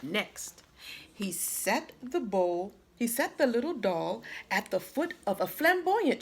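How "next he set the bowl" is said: ordinary delivery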